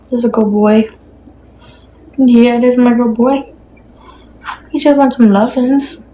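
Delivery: put-on sweet doggy baby talk, lightly lifted. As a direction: coo praise at the dog. Baby talk to her dog.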